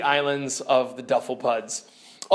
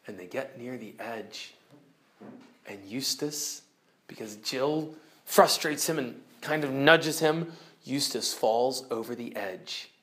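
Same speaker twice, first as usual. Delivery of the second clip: much softer, lower, tense telling; hushed suspense; 5 semitones lower, 6 dB quieter than usual. A narrator's reading. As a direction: draw them in quietly. Suspense at cliff edge.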